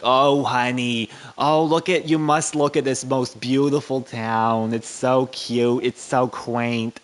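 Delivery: gushing, drawn-out Northerner; mock a tourist cooing over a quaint town; hammed-up slow, loud admiration; New York newcomers. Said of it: "and they're like".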